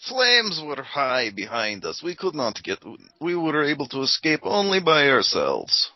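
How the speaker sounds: high, dramatic account; urgent escapee. Acted. Player in character answers guard.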